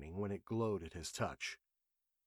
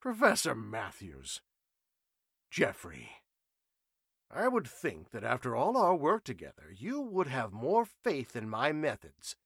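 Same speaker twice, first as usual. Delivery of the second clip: confident professor; 3 semitones higher, 3 dB louder than usual. A narrator's reading. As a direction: chide a doubting friend fondly. Trenchley chuckling, confident.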